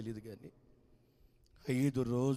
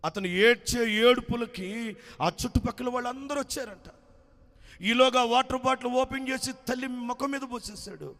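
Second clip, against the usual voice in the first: urgent narration; 10 semitones higher, 8 dB louder than usual. A narrator's reading. Narration swept up in panic.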